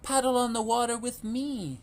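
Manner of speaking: sing-song calling mother duck. A narrator's reading. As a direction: call them over, mama duck. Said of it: "Mama called again".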